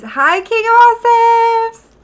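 high, loud, drawn-out hello; excited greeting. Real, not acted. Streamer happily greets a chatter arriving in chat. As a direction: sing out a welcome to a friend.

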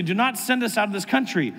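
ordinary talk, nothing marked

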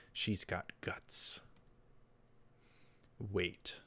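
normal talking voice

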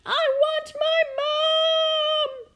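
put-on piercing, drawn-out child's scream. A narrator's reading. Princess scared of the monster under her bed, screaming. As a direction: scream it very high and loud for mum. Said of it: "she screamed".

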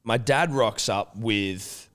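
ordinary talk, nothing marked

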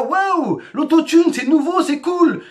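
mock-amazed gushing, pitched high; excited. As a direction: gush like a kid seeing something new. Mimics the excited 2005 kids. (imitation enthousiaste, voix haute)

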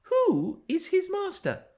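put-on very high, slow, girlish query; tiresome girl. A narrator's reading. The tiresome girl appealing to the narrator, high pestering voice. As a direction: pester in a child's high-pitched voice. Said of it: "continued the tiresome girl".

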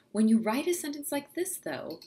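ordinary talk

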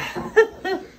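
high wheezing laugh; breathless high laugh; high breathy laugh. Real, not acted. Reacting to hot sauce.

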